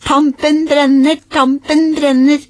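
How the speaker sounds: high, loud, slow sing-song call; excited calling out. Acted. Papa and Aurora shouting the clue as mamma nears the dresser, high and loud. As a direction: shout the hot clue gleefully. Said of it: "ropte både pappa og Aurora".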